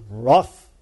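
low, lightly barked answer; a hint of a low dog bark; talking dog. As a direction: give the word a gruff woof. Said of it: "The dog goes".

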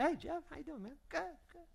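high, soft casual hello; breezy friend checking in; friendly greeting. Acted. A friend greeting Jeff at Christmas, high and light.

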